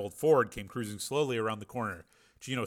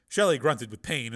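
ordinary delivery

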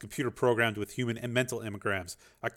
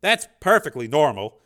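louder, higher forced calm; lying reassurance; 4 semitones higher, 9 dB louder than usual. A narrator's reading. Dev lying to the program, forced calm. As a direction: sell the lie. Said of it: "Dev lied".

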